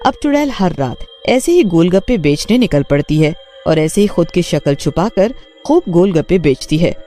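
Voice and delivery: ordinary delivery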